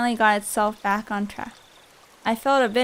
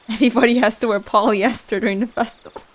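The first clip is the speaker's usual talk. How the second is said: loud, amused through laughter; laughing; 4 dB louder than usual. Real, not acted. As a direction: laugh your way through the line. Laughs at a line just read.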